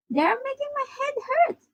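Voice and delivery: very high, squeaky complaint; distressed cry. A narrator's reading. Chopper pointing at the crew, high distressed complaint. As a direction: wail a complaint in a tiny high voice. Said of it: "he cried".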